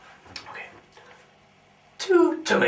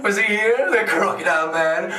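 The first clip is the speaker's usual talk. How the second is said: ordinary talk, nothing marked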